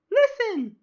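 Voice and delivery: piercing, very high loud fairy call; Navi. Acted. Fairy from Zelda calls for attention, shrill imitation. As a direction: squeak it out sharply in loud falsetto. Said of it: "the Navi voice from".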